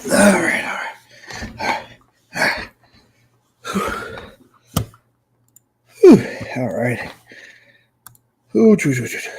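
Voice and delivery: panting between words; huffing after running upstairs; out of breath. Real, not acted. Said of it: "I'm out of breath".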